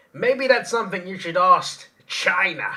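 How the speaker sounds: high, loud, slow attempt at a politician's voice; a rough impression, drawn out and loud; Donald Trump. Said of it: "I can't do his, I can't do an impression".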